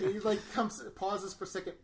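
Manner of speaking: laughing through the words; giggling as he retells it. Real, not acted. Laughing while telling friends the scene.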